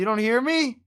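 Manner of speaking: high, loud disbelieving question; shouting in disbelief. Real, not acted. Friend can't hear him.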